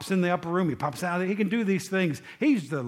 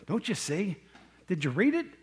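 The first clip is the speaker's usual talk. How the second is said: ordinary delivery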